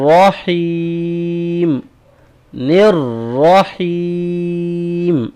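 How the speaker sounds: رحیم drawn out slowly, falling at the end; tajweed section demo; part three drill. Shown as a demonstration. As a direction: stretch رحیم in slow chant. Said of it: "اور number تین".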